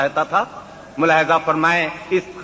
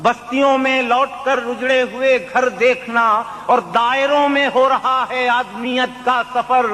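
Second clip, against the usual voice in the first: poetry recitation; 9 semitones higher than usual. Acted. Mushaira recitation.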